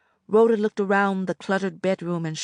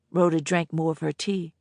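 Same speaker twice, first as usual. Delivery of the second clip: usual speaking voice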